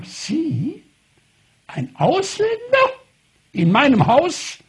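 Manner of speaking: shrill-high outraged doctor, voice jumping up; indignant astonishment. Retold or imitated. Doctor aghast at a foreigner, high indignant cry. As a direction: recoil at a foreigner in her home.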